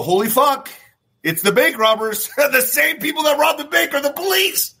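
high-pitched shocked outburst; freaking out. Acted. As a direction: build into a high, incredulous freak-out. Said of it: "you start freaking out".

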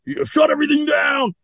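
quoting Bannon with a high shout; mock shout. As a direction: shout it like an outraged pundit. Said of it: "Bannon was all kinds of twisted on it".

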